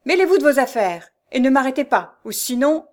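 menacing anger. A narrator's reading. Abductor tells newcomer not to interfere, menacingly angry. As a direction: order him off angrily. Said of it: "avec une colère menaçante".

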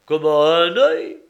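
loud, rising disbelief; astonished question. Acted. Listener hears the fish has one eye, astonished question. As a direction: blurt it out, taken aback.

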